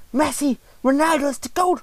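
high excitable streamer voice; imitating iShow Speed. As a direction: lightly mimic a hyped streamer. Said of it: "der, der ist da".

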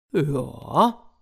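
a lightly growled bear yes; growling bear. A narrator's reading. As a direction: rumble it like a lazy bear. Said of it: "brummte der Bär".

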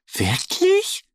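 zögerliche Frage, viel höher; Wirklich? unsicher und hoch fragen; zögerlich. A narrator's reading. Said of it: "fragt Annie zögerlich". (Ente fragt den Bären zögerlich und hoch)

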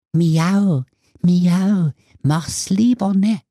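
high, mewing cat voice; warning cat. A narrator's reading. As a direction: mew a furtive warning as a talking cat. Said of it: "räudige Katze auf dem Buckel der Alten flüsterte ihm zu". (hohe, miauende Katzenstimme)

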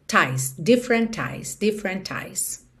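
"different ties": T of "different" joined to "eyes"; linking demo. Shown as a demonstration. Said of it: "juntou mesmo T do different em eyes". ("different eyes" ligado, soando "different ties")

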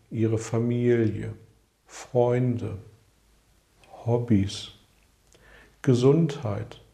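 unhurried, with long pauses; guided meditation. Acted. Guided visualization questions.

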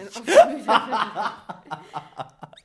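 slow, drawn-out, laughing mock repeat; mocking echo. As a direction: stretch every word out as a tease. Mocking repeat of partner's phrase.

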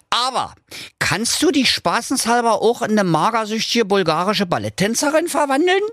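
high, mocking little dog, goading the wizard; cheeky dog voice. Dog Doktor Schulz goads the evil wizard, high and cocky.

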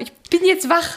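höher, schlaftrunken trotzig; genervt nachgemacht. Retold or imitated. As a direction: den genervten Partner nachäffen. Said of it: "weil du so extrem genervt". (genervt nachgeäfft)

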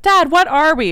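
high, loud mock kid voice; kid asking dad. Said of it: "I was like".

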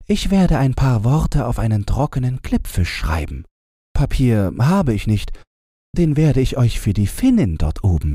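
put-on high, pitying old woman; high sympathetic crone voice; old Lapp woman. A narrator's reading. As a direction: pity the poor travellers. Lapp woman continues speaking.

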